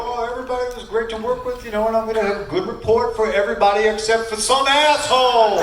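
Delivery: hammed-up trainer jab at one wrestler; mock Atlas yell. Imitating Tony Atlas calling out.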